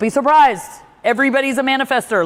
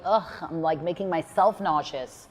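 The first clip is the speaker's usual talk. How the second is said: low, softened mock grumble; complaining; 4 semitones lower, 7 dB quieter than usual. Athlete griping to self mid-excuse, mock complaining. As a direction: complain half under your breath. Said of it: "I'll complain".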